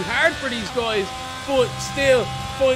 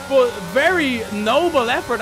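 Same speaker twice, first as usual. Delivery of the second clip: ordinary talk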